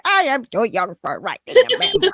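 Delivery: lightly voiced old grandma; a hint of a creaky grandma. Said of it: "that's my grandma impression".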